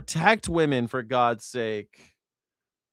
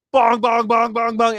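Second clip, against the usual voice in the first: imitating church bells; 7 semitones higher, 6 dB louder than usual.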